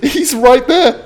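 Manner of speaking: laughing in disbelief. Real, not acted. Laughing as her friend appears at the gate. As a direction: giggle through the words.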